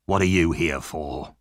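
low, rough demand from a sick thief; lightly rough, low-voiced Sikes. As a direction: growl the question down low at him. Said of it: "he asked roughly".

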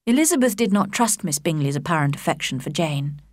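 ordinary delivery, nothing marked

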